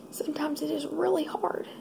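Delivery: crying whisper. Real, not acted. Admitting to viewers her calling is hard, crying. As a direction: let the tears break the line. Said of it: "I really didn't expect to cry".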